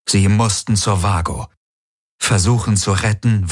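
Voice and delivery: ordinary delivery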